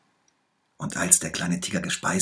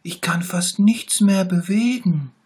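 sick little tiger; 13 semitones higher, 9 dB louder than usual. A narrator's reading. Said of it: "rief der kleine Tiger".

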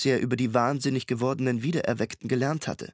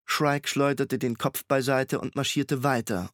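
usual speaking voice

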